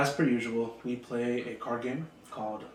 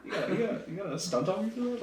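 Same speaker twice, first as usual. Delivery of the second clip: laughing protest; 9 semitones higher, 3 dB louder, faster than usual. Retold or imitated. Retells protest to mocking coworkers.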